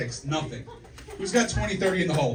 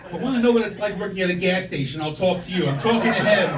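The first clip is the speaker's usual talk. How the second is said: loud, raised-pitch scolding; heckler put-down; 5 semitones higher, 10 dB louder than usual. Real, not acted. Comedian to interrupting audience member, loud scolding put-down. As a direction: snap back hard, building to the last words.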